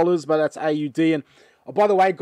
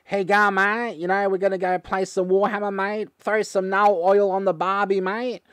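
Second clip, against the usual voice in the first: hammed-up Aussie ocker twang; mock broad Australian accent; 3 semitones higher than usual. Australian vlogger mocking how Aussies talk, broad Australian accent. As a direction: lay the Australian accent on thick, teasing. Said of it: "Aussies kinda talk like this".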